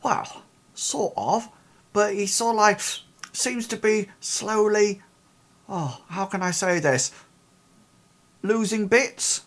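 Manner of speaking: high woman's voice, choosing words with pauses; hesitant Ida. Acted. Ida describing the decaying man to the investigators, a high hesitant woman's voice. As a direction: search for the words delicately. GM holds Ida's voice.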